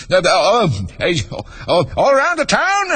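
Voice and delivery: put-on high, stammering old-king voice; very high, flustered, quivery king; nervous quivering. A narrator's reading. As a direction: dither and quaver, squeaking upward. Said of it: "his voice quite quivered".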